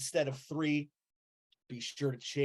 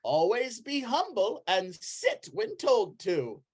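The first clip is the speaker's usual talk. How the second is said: high, emphatic preacher; pitched-up zealous cult brother; admonishing Brother Flufferkin; 7 semitones higher, 3 dB louder than usual. Acted. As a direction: lecture a convert on the tenets.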